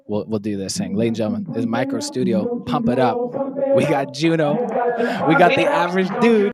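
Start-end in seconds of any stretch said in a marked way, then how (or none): 2.6-6.5 loud high hype shout-outs. Acted. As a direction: pump up the crowd. Hyped outro shout-outs.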